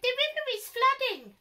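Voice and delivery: a tall giraffe with a tiny squeak, alarmed; squeaky giraffe. A narrator's reading. Giraffe warning the worried creatures in a high squeak. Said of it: "in a surprisingly squeaky voice".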